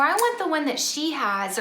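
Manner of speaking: mock kid whine; whiny complaint. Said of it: "grumble and complain".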